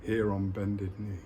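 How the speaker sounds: slow, measured poem reading with long pauses; poem recitation. Acted. Speaker sharing a poem with viewers, slow solemn recitation. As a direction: declaim each short line, then let it hang.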